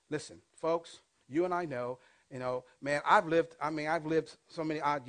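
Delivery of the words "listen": ordinary delivery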